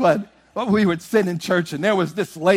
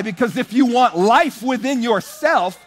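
high, rising preacher's drive; preaching at full force; 5 semitones higher than usual. Acted. Preacher urging the saints to give. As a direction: build it up like a revival preacher.

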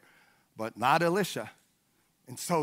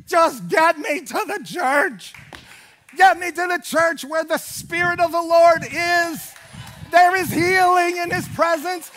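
raised, high preaching cry with stretched key words; impassioned plea; 6 semitones higher, 3 dB louder than usual. Retold or imitated. Preacher acts out begging to reach church, impassioned. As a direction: cry out to be dragged there, building in fervor.

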